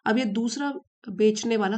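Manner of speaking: usual speaking voice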